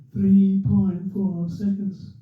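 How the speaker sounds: very slow, stretched-out announcement; radio commentator. Retold or imitated. Commentator repeating the winning margin, imitated drawn-out delivery. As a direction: draw out every word, commentator style. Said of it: "and I mouthed it as well".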